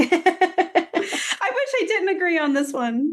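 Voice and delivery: high, drawn-out words through real laughter; laughing. Real, not acted. Co-host admitting to her partner she hates agreeing, laughing. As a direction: laugh your way slowly through it, pitched high.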